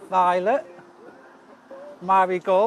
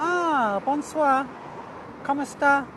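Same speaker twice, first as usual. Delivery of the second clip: higher, hammed-up French accent greeting; mock French greeting; 7 semitones higher, slower than usual. Greeting Paris viewer in mock French accent. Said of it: "Oh, you're in Paris".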